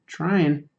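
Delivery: usual speaking voice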